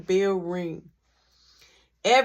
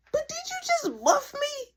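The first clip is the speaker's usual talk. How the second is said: shocked disbelief. Retold or imitated. Confronting the girl who groped him, high incredulous. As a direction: act out stunned outrage.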